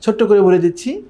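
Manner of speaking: normal talking voice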